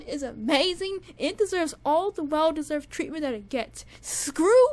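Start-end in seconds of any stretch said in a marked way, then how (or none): 4.0-4.7 mock outrage. Mock dismissing a song version.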